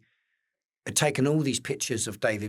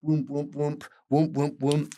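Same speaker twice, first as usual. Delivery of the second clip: mock whoosh of a hairdryer blowing; imitated hairdryer; 4 semitones higher, 3 dB louder, slower than usual. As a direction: puff out the hairdryer noise. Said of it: "And he went like".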